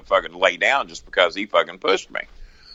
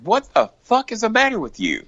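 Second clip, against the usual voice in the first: much higher, half-shouted disbelief; incredulous retort. Retold or imitated. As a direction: fire it back at him in shock. Confronting Brock after his threats.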